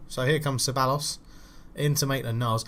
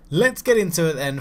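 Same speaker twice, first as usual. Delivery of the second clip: ordinary talk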